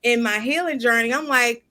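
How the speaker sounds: ordinary talk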